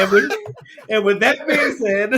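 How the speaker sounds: laughing through the sign-off. Real, not acted.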